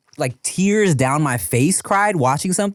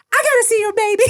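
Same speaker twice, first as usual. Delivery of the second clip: high, loud excited stranger's cry; eager neighbor; 4 dB louder, faster than usual. Retold or imitated. Neighbor stops truck for the baby, loud high excitement. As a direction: burst out like someone who must see the baby. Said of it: "got out, was like".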